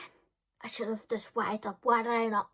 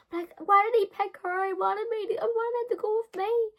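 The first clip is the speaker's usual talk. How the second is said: put-on high, loud whining teenage girl; whiny teen; 12 semitones higher, 4 dB louder than usual. Teen Brooklyn keeps begging Paula, high and whining. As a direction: plead like a heartbroken kid.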